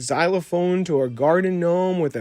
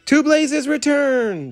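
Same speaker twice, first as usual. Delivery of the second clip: much higher chorus cheer; 12 semitones higher, 3 dB louder, slower than usual. Acted. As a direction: cheer it back, high and bright. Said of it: "the toys cheered".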